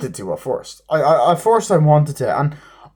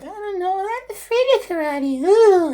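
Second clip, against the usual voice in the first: mocking squeaky voice. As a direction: squeak it out like a scared little kid. Mocking the kids' bravado.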